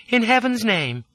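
high-pitched woman's astonishment; astonished exclamation. A narrator's reading. Lola to the intruder in her coach, exclaiming. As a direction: exclaim as if seeing a ghost. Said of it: "she exclaimed".

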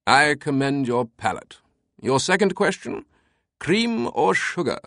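mock-heroic British voice; Herculean declaration. A narrator's reading. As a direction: declare it grandly in a British accent. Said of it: "his tone Herculean".

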